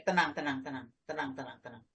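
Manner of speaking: mock calming, sinking to a murmur; fading hush. Teammates soothing the losing futsal player, fading softly. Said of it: "padha nenang nih".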